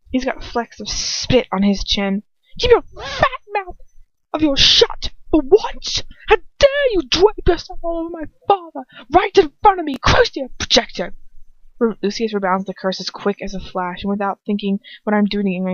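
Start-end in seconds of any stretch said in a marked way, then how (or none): 2.6-11.1 furious rant. Acted. Draco berating the narrator about his father, furious. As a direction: shriek it at her like a jealous brat.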